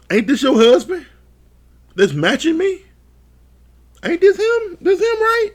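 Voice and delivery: high mock gossipy friend voice; friend asking. Friend outing the husband to her, high teasing voice. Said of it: "her friend told her".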